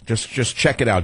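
ordinary talk

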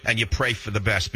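ordinary talk, nothing marked

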